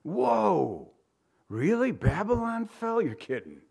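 mock astonishment with swooping pitch; stunned disbelief. Acting out reaction to shocking news.

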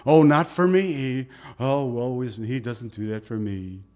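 mock self-pitying moan, sinking away; woe is me. Voicing a self-pitying doubter.

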